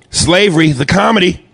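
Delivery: high-pitched comic movie tagline; mock promo announcement.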